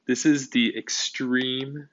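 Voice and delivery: ordinary delivery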